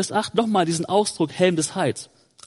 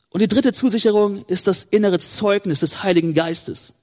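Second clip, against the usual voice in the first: ordinary talk, nothing marked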